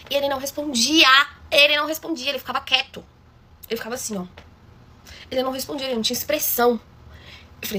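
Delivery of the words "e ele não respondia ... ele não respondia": ordinary delivery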